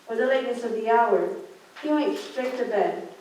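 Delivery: ordinary delivery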